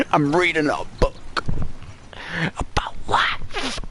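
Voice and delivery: the philosopher boss lecturing; hammed-up grandeur; mock pompous Dutch.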